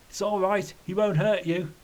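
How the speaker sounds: understated acted shout. A narrator's reading. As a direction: call out reassurance without much volume. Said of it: "Ken shouted".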